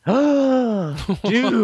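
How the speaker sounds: sharp gasp, then a high thrilled "Dude"; gasping delight. Retold or imitated. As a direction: gasp like you just got free HBO. Retelling the free-streaming offer.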